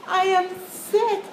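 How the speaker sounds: a happy surprised squeal; delighted gasp. Acted. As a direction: gasp as if the news is wonderful. Piggie gasps at news.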